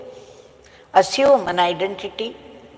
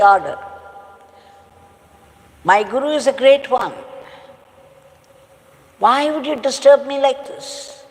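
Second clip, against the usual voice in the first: indignant protest; 4 semitones higher than usual. Retold or imitated. Disciple protests to the king.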